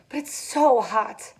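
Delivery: near tears. A narrator's reading. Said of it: "on the verge of tears".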